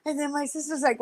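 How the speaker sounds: ordinary talk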